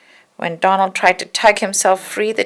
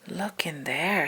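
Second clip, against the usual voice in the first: soft slow whisper; 10 dB quieter, slower than usual. A narrator's reading. Dale whispering to Chip by the cottage. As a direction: let them in on a secret. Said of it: "he said".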